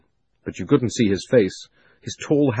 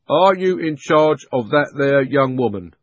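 slow policeman; 5 semitones higher, 6 dB louder than usual. A narrator's reading. Policeman questioning.

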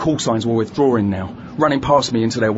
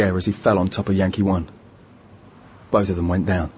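hushed tense narration; 5 semitones lower, 3 dB quieter than usual. A narrator's reading. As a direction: hold your breath and tell it low. Sniper shot narrated low.

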